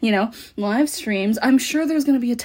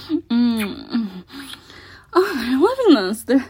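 ordinary talk, nothing marked

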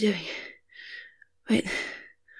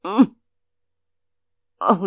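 usual speaking voice

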